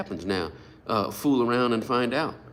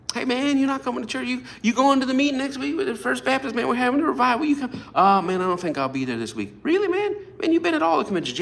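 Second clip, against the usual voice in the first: pushy church friend; 12 semitones higher, faster than usual. Retold or imitated. Church friend pressures him to come.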